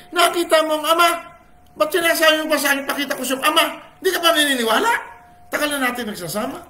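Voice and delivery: high, loud, laughing exasperated rebuke; half-laughing, incredulous teacher pushing his disciple; Jesus chiding Philip. Retold or imitated. Jesus scolding Philip for not believing, high and laughing.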